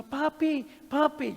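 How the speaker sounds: high, scared call for dad; frightened child's cry. Retold or imitated. Daughter startled awake calls her father, high and frightened. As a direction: call out like a scared little girl. Daughter wakes crying for papi.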